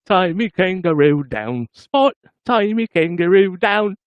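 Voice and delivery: slow, high Australian accent chant. Shown as a demonstration. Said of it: "did I get the accent?".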